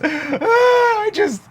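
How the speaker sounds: high, sobbing little voice; hammed-up tearful cry, pitched high; crying acorn. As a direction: wail it like a heartbroken acorn.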